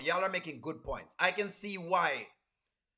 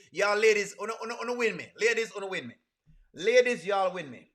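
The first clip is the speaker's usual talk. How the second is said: playful call-out; 3 semitones higher, 5 dB louder than usual. Acted. Teasing the ladies in chat.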